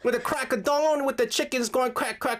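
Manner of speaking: high-pitched, hammed-up Southern accent; bad Southern accent. Host attempts Georgia talk, badly faked high Southern accent. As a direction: badly fake a folksy Southern farmer. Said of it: "Oh, no, he's bad".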